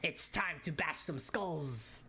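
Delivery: slow, gruff battle cry; rowdy brawler voice. Acted. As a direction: growl it like a pirate brute. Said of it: "Ichiban goes".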